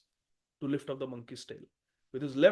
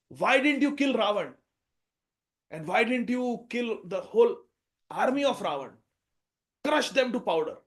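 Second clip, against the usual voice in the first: higher, louder, heated demand; indignant questions; 5 semitones higher, 7 dB louder than usual. Acted. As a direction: challenge him, fired up. Bhim demands why Ravan lived.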